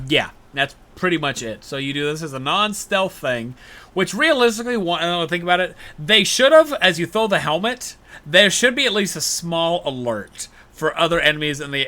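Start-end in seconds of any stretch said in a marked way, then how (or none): none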